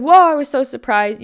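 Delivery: loud, high, taken-aback whoa; startled whoa. Retold or imitated. Woman answering the yelling tow driver, loud startled whoa. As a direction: gasp it out, caught off guard. Retelling her startled reply.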